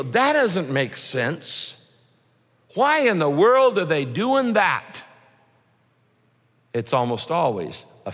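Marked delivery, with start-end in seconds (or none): 0.0-5.0 baffled disbelief. Retold or imitated. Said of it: "somebody does something that you go".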